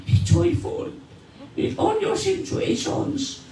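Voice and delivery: high, breathy, slow near-tears plea; a dying apostle's tearful urging. Retold or imitated. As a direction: voice Paul as if he is about to weep. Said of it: "Maaaring umiiyak si Paul".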